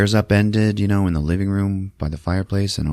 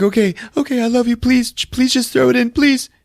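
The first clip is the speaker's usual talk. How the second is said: tearful pleading. Retold or imitated. Wife begging husband to throw the book, high tearful plea. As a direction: plead with him through tears, pitched way up. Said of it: "she's, like, saying goodbye to him".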